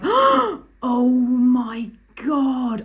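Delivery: mock horror, stretched right out; retold shock. Instructor in the back seat, slowly aghast at the student. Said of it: "I was so shocked".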